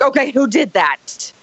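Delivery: hammed-up who-did-it alarm; dramatic alarm. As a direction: play up the fright to the girls. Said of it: "I am kind of a drama, um, person".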